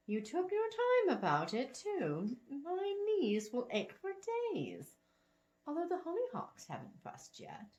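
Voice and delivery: put-on a sweet old woman, pleased; elderly lady.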